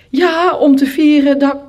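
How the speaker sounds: high, loud flustered answer; flustered professor. A narrator's reading. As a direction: blurt out the excuse as the professor. Nervous professor answers in the story. (luide, zenuwachtige professor)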